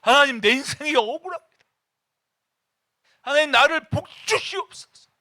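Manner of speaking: high, loud desperate plea; screaming prayer. Acted. Praying at the top of one's lungs for blessing. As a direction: strain the voice, pleading with all you have. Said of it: "막 악을 쓰고 기도해".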